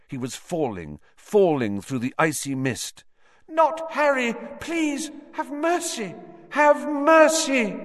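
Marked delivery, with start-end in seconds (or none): 3.5-7.8 screaming woman. Acted. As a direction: beg for the child's life in terror. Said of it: "The woman was screaming".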